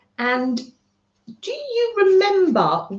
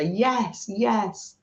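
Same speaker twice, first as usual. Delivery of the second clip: normal talking voice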